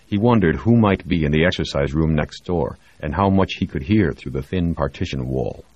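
usual speaking voice

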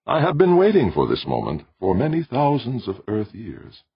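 high, calm tenor; composed, high voice of an ancient mind; ancient sentient machine. A narrator's reading. Ancient machine tells of its long wait, calm tenor.

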